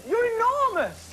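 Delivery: tiny shrunken voice. Pretend. Shrunken Matthew marvels at giant Sooty, squeaky and high. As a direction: sound like you've shrunk to a few inches. Shrunk man sees the children.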